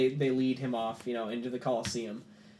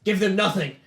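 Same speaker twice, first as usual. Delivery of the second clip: furious shout, much higher and louder; shouting defiance; 8 semitones higher, 11 dB louder than usual. Acted. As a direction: bellow it at the enemy in rage. NPC Arondir shouting defiance.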